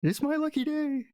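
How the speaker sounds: high voice that slips off the mouse character; wrong character voice. Acted. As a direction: miss the mouse voice slightly, pitched high. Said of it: "That's the wrong voice".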